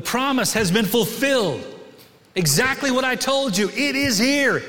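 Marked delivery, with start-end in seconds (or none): none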